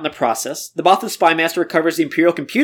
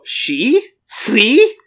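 higher, louder, incredulous; mock surprised she; 5 semitones higher, 3 dB louder than usual. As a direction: act scandalized by the word. Mock shock.

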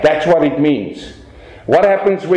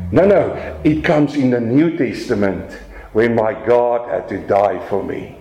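normal talking voice

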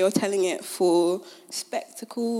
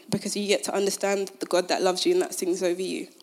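ordinary talk

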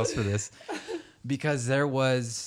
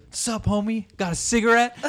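much higher, louder mock street-guy voice; mock pushy stranger; 11 semitones higher, 6 dB louder than usual. Retelling the parking-lot guy hassling a dad, mock pushy stranger. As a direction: ham up the pushy stranger bumming a smoke. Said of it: "he goes".

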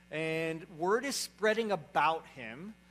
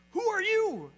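shouted high and loud, a possessed man's challenge; high, loud demonic outcry; 10 dB louder than usual. Acted. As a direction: cry out as the demon confronting Jesus. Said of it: "imagine the tone in his voice".

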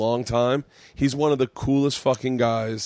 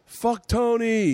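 high, slow drawn-out chant; mock crowd yell; 10 semitones higher, much slower than usual. Shown as a demonstration. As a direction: yell it like a heckler.

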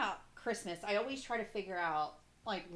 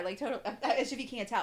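normal talking voice

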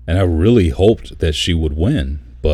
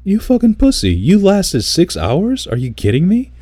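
much higher, louder scornful yell; 13 semitones higher, 4 dB louder than usual. Retold or imitated. Retelling his outburst at the vet who quit, scornful yell. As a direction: rip into the quitter like he's in the room. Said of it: "And I'm like".

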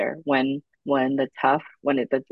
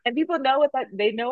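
laughing through the words, pitched up; 9 semitones higher than usual. Real, not acted. Podcast host laughing while telling friends about salsa. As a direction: laugh it out mid-sentence.

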